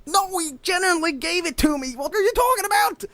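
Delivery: high, exasperated whine; acted-out indignant pleading; desperate protest. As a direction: insist you're innocent, voice climbing.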